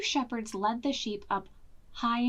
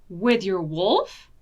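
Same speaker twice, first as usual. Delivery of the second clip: doubtful farmer; 3 semitones lower, 6 dB louder, slower than usual. Acted. Farmer doubting Betsy's wolf.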